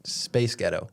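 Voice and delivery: confident put-on American accent. Shown as a demonstration. Co-host showing the host how, American accent. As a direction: say it in plain American. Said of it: "Yeah, I can do American accent".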